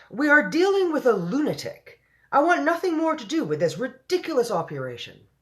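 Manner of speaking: loud, high-pitched official's outburst; furious tantrum. A narrator's reading. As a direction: explode in outrage at the Queen. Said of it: "began to swell with fury".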